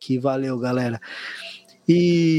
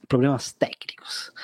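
joking stage whisper. Shown as a demonstration. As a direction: whisper it like a secret. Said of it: "Assim que a gente fala".

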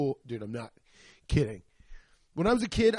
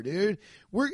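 ordinary delivery

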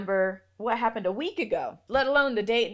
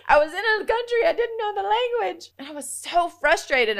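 high, loud comic exasperation; hammed-up high-pitched complaint; exasperated; 10 semitones higher, 9 dB louder than usual. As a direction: wail it in mock frustration after a laugh. I was so frustrated.

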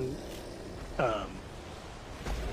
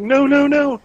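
much higher, loud panicky no; alarmed protest; 12 semitones higher, 10 dB louder than usual. Retold or imitated. Trevor protests in the story.

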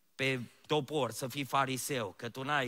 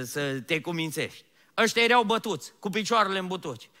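loud, high climbing sermon; full-force preaching; 8 semitones higher, 12 dB louder than usual. Acted. Pastor telling of Paul and Silas, loud preaching. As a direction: drive the story home at full voice.

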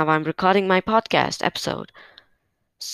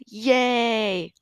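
long drawn-out, higher "yay"; celebratory cheer; 5 semitones higher than usual. Pretend. Podcaster celebrating small wins with listeners, drawn-out cheer.

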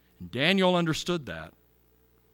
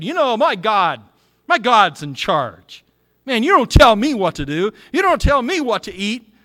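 loud, higher indignant retort, hammed up; defiant demand; 5 semitones higher, 8 dB louder than usual. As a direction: snap back at the king like a proud rebel. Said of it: "he could have said".

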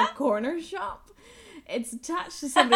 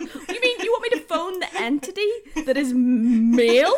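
sarcastic disbelief; 6 semitones higher, 9 dB louder than usual. Retold or imitated. Retold sarcastic reply.

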